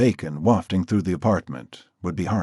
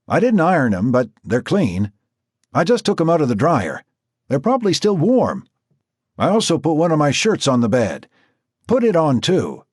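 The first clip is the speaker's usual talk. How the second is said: raised voice from the kitchen; calling out; 9 semitones higher, 6 dB louder than usual. Acted. Jack calling to Marcy in the bathroom, raised voice. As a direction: keep calling from another room.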